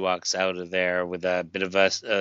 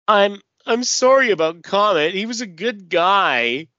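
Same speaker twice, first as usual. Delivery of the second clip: put-on higher, hesitant young hero voice, unsure of himself; awkward apology as Beck; 10 semitones higher, 7 dB louder than usual. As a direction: stumble through the sympathy, pitched up. Beck consoling grieving Mary Jane.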